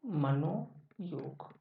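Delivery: drawn out very slowly and softly, syllable by syllable; slow dictation. Shown as a demonstration. Teacher dictating the answer to students, slow and soft. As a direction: stretch मनोयोग out slowly while writing it. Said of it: "क्या हो जाएगा आपका ये?".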